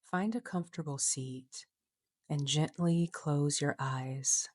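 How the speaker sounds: measured, unhurried instructions with long pauses between phrases; guided meditation. Acted. Coach leading listeners into a short meditation, guided meditation voice.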